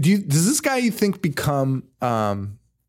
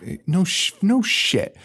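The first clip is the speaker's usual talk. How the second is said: sarcastic no shit; 7 semitones higher than usual. Retold or imitated. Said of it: "I'm like".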